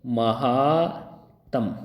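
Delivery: महात्म drawn out very slowly in separate syllables; very slow, broken महात्म. Shown as a demonstration. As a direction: spell out महात्म by sound: ma... haa... tam. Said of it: "ये शब्द है".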